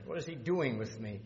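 ordinary talk

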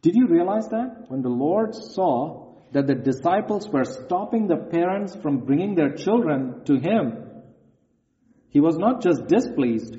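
usual speaking voice

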